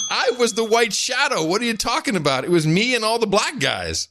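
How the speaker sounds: high-pitched, amused protest; animated comic indignation. Real, not acted. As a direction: push back at the teasing, pitch way up. Mock-indignant reply to being teased.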